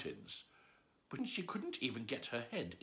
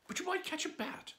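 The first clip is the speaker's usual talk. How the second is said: much higher bright suggestion; cheerful Alice. Acted. Alice cheering herself about Dina, much higher voice. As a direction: lift it up like a hopeful idea. Alice consoles her cat.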